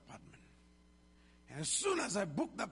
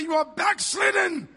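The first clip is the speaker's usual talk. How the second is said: much higher, much louder accusation; wife's accusing shout; 11 semitones higher, 13 dB louder than usual. Retold or imitated. Pastor imitating his wife accusing him, high shout. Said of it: "my wife will say".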